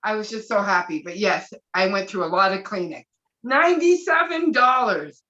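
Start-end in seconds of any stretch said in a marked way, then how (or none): 3.4-5.2 loud, high, thrilled exclamation; excited shout. Real, not acted. Guest shouting happily about the refund. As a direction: shout the amount with joy.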